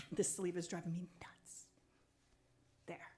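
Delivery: a soft whispered aside to herself. Real, not acted. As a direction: breathe it out, annoyed at the sleeve. Muttered aside while adjusting sleeve.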